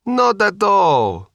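put-on high, slow Sicilian accent; drawn-out Sicilian host reply. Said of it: "with a thick Sicilian accent".

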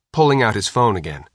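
ordinary delivery, nothing marked

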